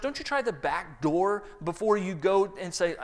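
ordinary talk

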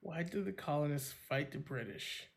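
soft musing to oneself; thinking aloud. Real, not acted. Quiz contestant mulling over the question, quietly thinking aloud. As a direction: repeat the question under your breath, pondering.

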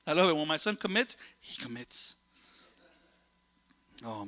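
usual speaking voice

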